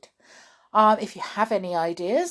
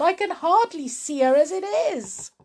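high-pitched fretful wail; distressed woman's high voice; 10 semitones higher than usual. Acted. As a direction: wail with rising worry, voice very high. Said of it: "Missus Cranky wailed".

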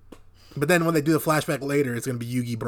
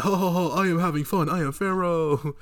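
high, hammed-up royal laugh and boast; jolly mock Pharaoh; 5 semitones higher than usual. The Pharaoh at the arcade, hammed-up and pompous. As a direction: play a pompous pharaoh having fun. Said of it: "He's like".

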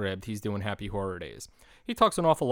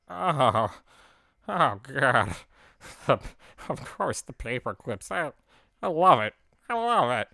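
louder, higher, gushing muppet delight with a laugh; overjoyed puppet; 4 semitones higher, 4 dB louder than usual. Retold or imitated. Ernie thanking Bert for the gift, gushing high and loud. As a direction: squeal over the present like a muppet.

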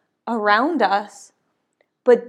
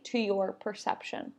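ordinary talk

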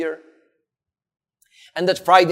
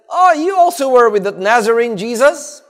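higher, louder accusing question; accusing servant girl; 7 semitones higher, 6 dB louder than usual. Acted. Servant girl spotting Peter by the fire, accusing. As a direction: pipe up high and loud with an accusation.

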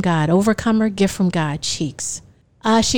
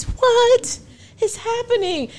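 panicked; 12 semitones higher, slower than usual. Retold or imitated. Calling her friends in panic.

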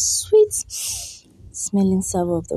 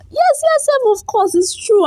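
much higher, playful yes yes; high insistent yes; 4 dB louder than usual. Real, not acted. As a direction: squeak the yeses to insist.

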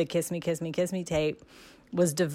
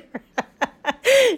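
a loud, high laugh; high burst of laughter; 7 dB louder than usual. Real, not acted. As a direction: laugh out at the memory. Laughs at memory of crying.

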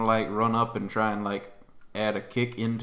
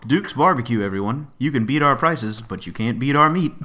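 ad read; 7 dB louder than usual. Acted. Mock ad read for barbecue sponsor.

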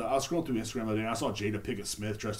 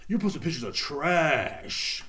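slower, falling mock accusation; mocking critics; 3 semitones higher, slower than usual. Critics attacking his Instagram posts, in a slow disdainful mock. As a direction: let it sink in like a disdainful critic. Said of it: "people, like, talk shit about me".

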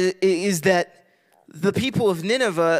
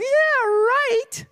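much higher, mocking Yeah, right; sarcastic scoff. Jonah brushing off God's order with a sarcastic scoff. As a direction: toss it off like an absurd joke.

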